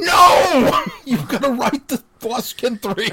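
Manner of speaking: a fan's hammed-up protest, slowing on the demand; mock outraged shout. Fan shouting at Andy for taking the day off, mock outrage. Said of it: "I'm sitting here going".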